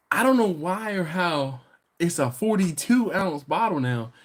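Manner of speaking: ordinary talk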